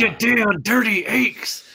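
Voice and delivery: slow Heston line through gritted teeth. Acted. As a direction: grit your teeth, drag it out. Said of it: "Charlton Heston, everything is through gritted teeth".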